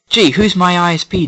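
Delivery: loud, high mock wondering; hammed-up sarcastic question; mock sarcasm. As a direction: ask it with exaggerated sarcasm. Acted sarcastic retort to advert.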